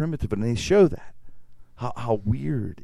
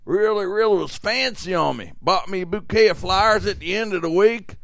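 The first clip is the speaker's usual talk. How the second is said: higher, lightly voiced Calamity Jane recounting her date; Calamity Jane telling; 10 semitones higher than usual. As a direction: tell it like a proud frontier woman, a bit higher. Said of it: "she goes".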